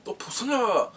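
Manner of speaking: high-pitched teasing exclamation; mock-amazed jeer; mocking kids. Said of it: "these kids are making fun of them".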